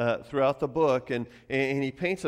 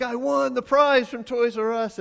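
put-on excited kid; 12 semitones higher, 8 dB louder than usual. Excited boy after the phone call.

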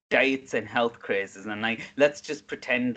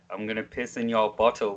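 ordinary talk

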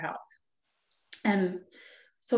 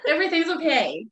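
mock reassuring; 9 semitones higher, 4 dB louder than usual. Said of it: "I was like".

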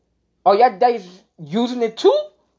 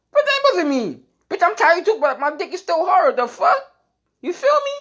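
heated rant; 12 semitones higher than usual. Acted. Ranting about partner's excuse.

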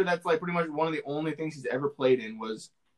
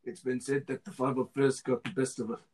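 amateur voice acting in a New Zealand accent; bad New Zealand accent; 3 semitones lower than usual. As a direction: narrate like a clone in a shaky New Zealand accent. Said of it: "some quality voice acting here".

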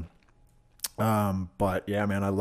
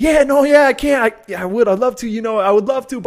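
high, loud hedging; exaggerated apologetic stammering; reluctant excuse; 11 dB louder than usual. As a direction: act out squirming out of sharing. Acted stoner refusing reluctantly.

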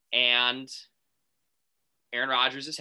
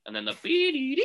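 mock phone beep; 14 semitones higher than usual. Imitating phone line beep.

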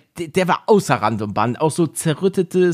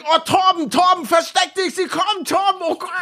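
high, frantic classmates calling out; panicked shout. Retold or imitated. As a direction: shriek in mock panic.

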